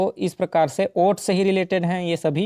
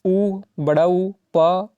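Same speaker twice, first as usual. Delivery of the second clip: slow vowel reading; 2 semitones higher, 3 dB louder, slower than usual. Shown as a demonstration. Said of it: "बताइए".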